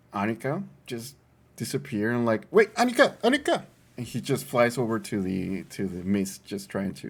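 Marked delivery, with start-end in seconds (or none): 2.5-3.7 high, loud panicked calls; frantic calling. Acted. Owl calling out to vanishing Annika in a high panicked shout. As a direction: shout after her as she vanishes.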